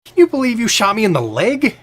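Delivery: loud, high, incredulous protest; indignant complaint. Acted. Wounded man to the woman who shot him, loud indignant protest. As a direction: protest the injury in disbelief, pitched high.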